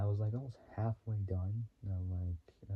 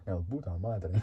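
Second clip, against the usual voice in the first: imitated truck driver; 5 dB louder than usual. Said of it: "The way he talks".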